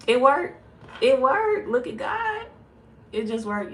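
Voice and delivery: loud, high-pitched excited cry; real elation. Real, not acted. Reader to viewers as charger works, elated shout. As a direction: shout it in delighted surprise. Phone charger suddenly starts working.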